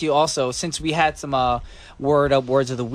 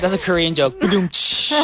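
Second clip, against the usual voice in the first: usual speaking voice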